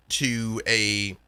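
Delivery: usual speaking voice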